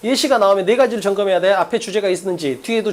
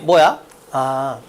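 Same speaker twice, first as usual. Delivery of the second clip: ordinary talk, nothing marked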